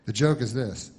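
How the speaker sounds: ordinary talk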